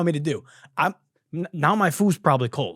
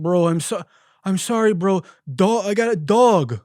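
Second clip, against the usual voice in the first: higher, louder, strained pleading; a flustered, higher-voiced delivery guy saying sorry; apologetic hurt driver; 6 semitones higher, 5 dB louder than usual. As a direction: play the mauled driver begging pardon.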